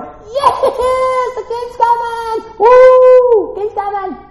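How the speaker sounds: high-pitched acted cheering; hammed-up celebrating onlooker; cheering crowd. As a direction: whoop like someone hailing a king. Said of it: "and they're like".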